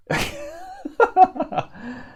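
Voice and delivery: high burst of laughter; a delighted high-pitched laugh. Real, not acted. Laughing at the jester's cheeky question, real laugh.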